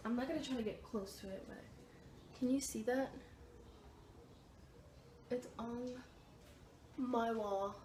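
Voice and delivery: very soft, cautious aside; hushed, careful pointing-out; wary hushed voice. Real, not acted. Edging near a wasp to show it.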